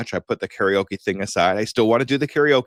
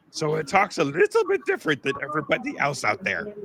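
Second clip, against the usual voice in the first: higher, odd cartoon character voice; homemade cartoon voice; 6 semitones higher than usual. Shown as a demonstration. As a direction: mix three cartoon characters into one. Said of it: "So I made that one up myself".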